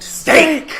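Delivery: excited shout. Real, not acted. Excited answer about dinner.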